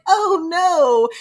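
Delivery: high loud mock "oh no"; mock dismay. Violet seeing the Venin survive, hammed-up dismay. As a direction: gasp it out in fake shock. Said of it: "she's like".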